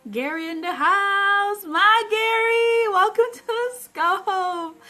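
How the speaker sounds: slow, high, loud announcer-style welcome; drawn-out hype greeting. Real, not acted. Host hypes arriving viewer.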